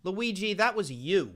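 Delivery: loud, high mocking yell; angry shout. Real, not acted. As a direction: yell at him for being an idiot. Yelling at friend who scared himself.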